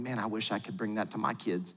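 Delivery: low, soft private admission; wistful inner thought. Acted. Parent seeing friends' gifts on Instagram, quietly wishful. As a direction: drop pitch and volume, as if confessing to yourself.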